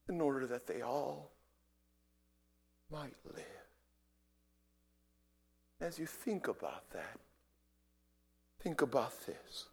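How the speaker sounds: very soft, solemn appeal with long pauses; nearly murmur, letting each question hang; hushed preaching. Real, not acted. Preacher asking what the prisoners felt, hushed.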